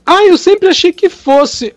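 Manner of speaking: lightly mocked teacher, high and slow; imitated surprised teacher. Teacher caught mistaken by the father, mock high excuse.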